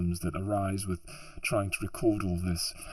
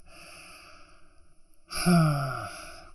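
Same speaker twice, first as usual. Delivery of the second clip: long, weary sigh of frustration; exasperated sigh; 4 semitones higher, 4 dB louder than usual. Real, not acted. Venting about unpaid hard work.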